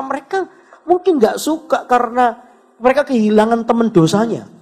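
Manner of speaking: normal talking voice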